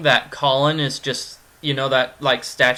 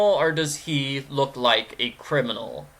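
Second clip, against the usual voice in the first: second option dropped back down. Acted. Poses the same question two ways.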